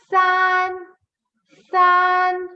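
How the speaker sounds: SAN with an ah, high, level and loud; correct SAN vowel. Shown as a demonstration. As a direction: hold SAN as "saahn" on a high note. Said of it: "It is".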